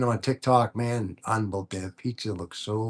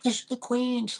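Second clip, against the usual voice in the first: much higher pitch for the wife's panic; mock freaking out. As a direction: imitate a panicked wife spotting Queens. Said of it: "like, freaking out".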